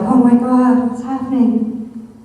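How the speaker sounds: a hammed-up alarmed gasp of a line; mock freak-out. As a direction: pretend shock that trails off slow. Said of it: "he didn't freak out".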